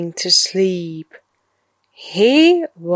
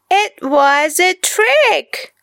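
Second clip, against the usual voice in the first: put-on high boy voice, laughing through it; 9 semitones higher than usual. A narrator's reading. Boy admits the trick, laughing. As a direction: gloat with a giggle. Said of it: "laughed Kipper".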